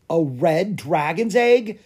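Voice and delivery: slow, high, astonished question; exclaiming. A narrator's reading. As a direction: exclaim it in disbelief. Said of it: "exclaimed Clara".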